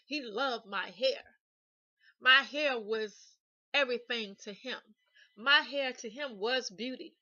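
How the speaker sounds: loud, punched words in "My hair was everything to him"; emphatic stress. Real, not acted. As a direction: drive home "everything" and "beauty" slowly and forcefully. Stressing how much hair mattered to him.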